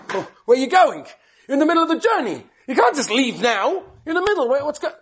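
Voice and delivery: high, urgent disbelief; alarmed protest. Retold or imitated. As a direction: stop him from walking out mid-journey. Rosh Yeshiva protests student leaving.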